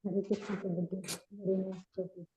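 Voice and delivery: very soft, far from the mic; speaking too quietly to hear; barely audible. Real, not acted. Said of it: "your voice is very low".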